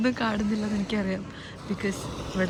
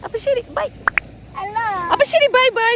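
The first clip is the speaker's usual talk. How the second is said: sing-song goodbye; 13 semitones higher, 4 dB louder than usual. Real, not acted. High sing-song goodbye to child.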